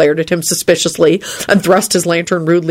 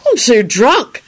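high-pitched tipsy excuse; carefree, pitched-up boy; cheerful; 6 semitones higher, much slower than usual. A narrator's reading. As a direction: admit it gleefully, voice lifted. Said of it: "Harry grinned at him cheerfully".

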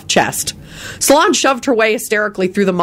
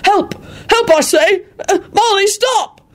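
high panicky pleading; panicked cries; 14 semitones higher than usual. A narrator's reading. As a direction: beg her to stop while fleeing. Chased by angry wife.

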